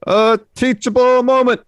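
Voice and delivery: very high, loud, drawn-out call; catchphrase shout. Acted. Co-host completing Chase's catchphrase, mock crowd shout. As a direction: belt it out slowly like a crowd catchphrase.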